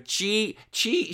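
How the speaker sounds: high, broken-off syllables; imitated little girl. Little girl starting to repeat the word, imitated high voice. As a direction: try the word like a small child. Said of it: "So this little girl's going".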